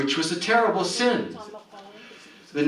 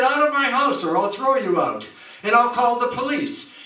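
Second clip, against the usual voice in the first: higher, raised angry threat; 8 semitones higher than usual. Acted. Rich man threatening Peggotty with police, high and angry. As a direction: threaten to throw her out, voice high. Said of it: "He said".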